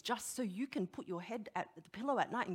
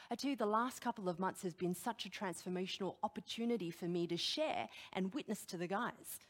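ordinary talk

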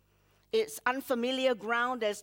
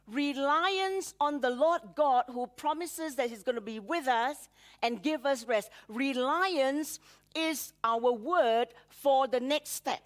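ordinary talk

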